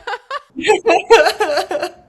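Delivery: a loud high laugh bursting out; loud delighted laugh; loud high laughter. Real, not acted. Laughing at friend's joke.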